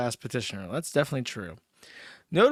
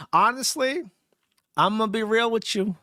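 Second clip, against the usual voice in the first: high mock aside; 6 semitones higher than usual. High put-on aside to chat.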